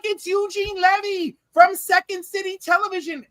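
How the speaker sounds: high-pitched, insistent excitement; excited young fan. Retold or imitated. Young self telling Canadian father who the actor is, excited. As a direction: gush it up high, drawing out the last letters. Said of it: "I started screaming".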